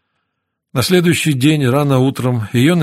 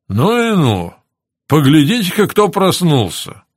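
higher, swooping delighted exclamation; pleasantly surprised; 4 semitones higher than usual. A narrator's reading. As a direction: greet a waking patient with glad surprise. Doctor delighted patient woke up.

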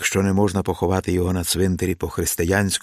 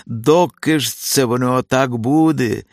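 raised, higher-pitched complaint; old man's loud lament; grumbling old man; 6 semitones higher, 5 dB louder than usual. A narrator's reading. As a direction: groan it out loudly in exasperation. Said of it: "закриктав ще з порога Відкуп".

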